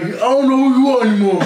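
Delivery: fast Stallone voice, jaw out; quick Rocky impression, dumb lip; underbite. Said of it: "Sylvester Stallone impersonator".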